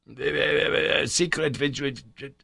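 halting, caught-out repeats; stammering. A narrator's reading. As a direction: stumble over the words, flustered. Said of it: "stammered my uncle".

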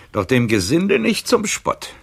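high, loud proud refusal; defiant outburst. Acted. Old librarian defying the count while burning his papers. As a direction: fling it out in wounded pride.

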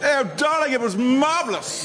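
high luvvie gush; theatrical Shakespeare. Acted. Shakespeare back from London, theatrical gushing. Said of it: "went a bit theatrical in London".

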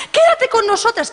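very high pleading voice; desperate plea. Acted. Desperate friends beg her to stay, very high plea. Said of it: "Sus amigas ya desesperadas".

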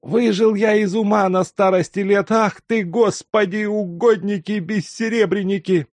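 put-on high, loud old man scolding himself; an old servant overjoyed and flustered; fussing old servant. A narrator's reading. Old servant Luka fusses.

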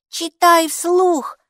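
put-on high, slow childlike demand; a small girl insisting, drawn out; demanding little girl. A narrator's reading. Mikey demands mom read aloud, high slow child voice. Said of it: "потребовала Майки".

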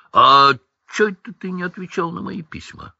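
put-on character voice, pitched well up; Hagrid's questioning. A narrator's reading.

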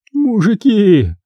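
bewildered, high and drawn out; Клыкова. A narrator's reading. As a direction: call out in high, slow bewilderment. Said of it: "растерялась Клыкова".

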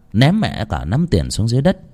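ordinary delivery

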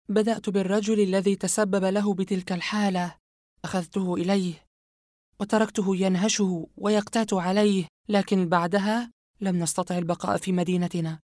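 Yumna's voice. Acted. Yumna confessing her brother's story to Asem, cold and high. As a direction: calmly tell the dark tale, a sinister woman.

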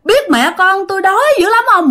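high wailing voice, sobbing through the words; high, tearful lament of a hungry ghost mother; crying ghost plea. Retold or imitated. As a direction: wail it like a starving weeping ghost. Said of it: "Mà vừa khóc mà vừa nói như vậy".

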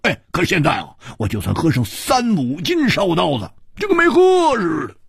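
high, exclaiming character voice; boastful drinker. Acted. Drinker grumbling that wine no longer works on him, boastful.

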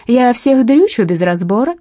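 broad Aussie twang, hammed up; exaggerated Australian accent. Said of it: "продолжает с утрированным австралийским акцентом".

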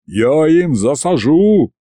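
mild, drawn-out yell; slow, blustering threat; yelling dragon head. A narrator's reading. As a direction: bellow it half-heartedly as a dragon. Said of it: "снова заорала правая голова".

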